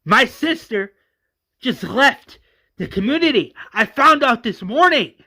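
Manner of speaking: high, loud anguished outburst; Johnny screams. Acted. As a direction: cry it out in panic. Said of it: "Johnny screams".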